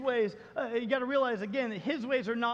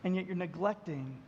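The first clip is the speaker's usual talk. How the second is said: gentle God speaking; 4 semitones lower, 5 dB quieter than usual. Retold or imitated. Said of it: "And God says".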